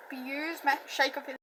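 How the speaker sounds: plain reading voice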